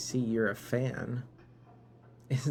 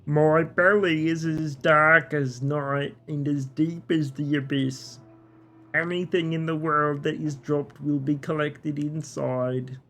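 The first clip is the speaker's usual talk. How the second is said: put-on talking bin in a muddled Dutch or Eastern European accent; vague European accent; 2 semitones higher, 4 dB louder than usual. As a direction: proclaim it in an exaggerated, unplaceable European accent. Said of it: "Is it Dutch now? This is Eastern Europe?".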